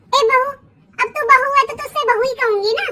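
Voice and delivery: usual speaking voice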